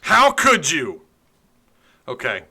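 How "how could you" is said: high, loud, slow mock outrage; outraged yell. Mock outrage at the dead webcam.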